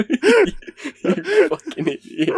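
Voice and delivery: high, slow, laughing through it; giggling as you call him an idiot; laughing insult. Real, not acted. Laughing at friend's blunder.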